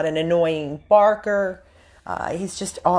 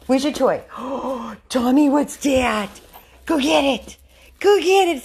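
high, loud, gasping excitement for a dog; excited play talk; 7 semitones higher, 5 dB louder than usual. Real, not acted. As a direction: rev the dog up to fetch. Exciting the dog about a toy.

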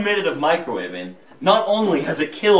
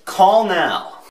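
infomercial; 3 semitones higher, 3 dB louder than usual. Acted. Ad closing call.

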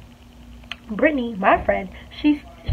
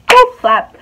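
"boop, slap" shot out much higher and louder; mock slap sound; 12 semitones higher, 12 dB louder than usual. Showing how Tasha would slap her, loud mock sound effect. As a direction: pop the slap noise out.

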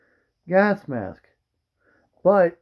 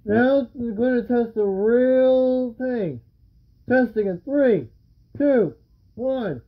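muffled behind mask; speaking inside respirator; through a gas mask; 7 semitones higher, slower than usual. Real, not acted. Seen 2: Full-face gas mask with filter canister worn throughout labelled words.

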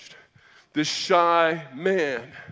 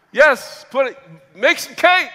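eager yes; 9 semitones higher, 10 dB louder than usual. Retold or imitated. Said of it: "reply to brother".

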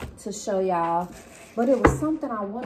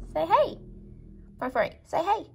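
light pet coax; 7 semitones higher than usual. Real, not acted. Owner asking Frank to greet the viewers, lightly high. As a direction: prompt him sweetly.

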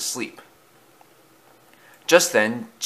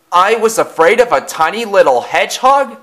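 high, loud astonished question; incredulous; 10 semitones higher, 7 dB louder than usual. A narrator's reading. Thomas sees his tiny monster, loud incredulous question. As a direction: exclaim in disbelief at yourself.

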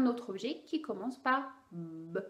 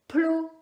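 "Plo" said higher and louder, p burst hard; loud exploded p; 7 semitones higher, 9 dB louder than usual. Shown as a demonstration. Therapist testing the child, exploding p. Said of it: "on continue".

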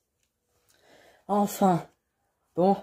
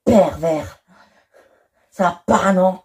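loud, higher indignant outburst; outraged shout; 4 semitones higher, 4 dB louder, slower than usual. Acted. Woman rejecting a pervert, loud outraged yell. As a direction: snap back in shocked disgust.

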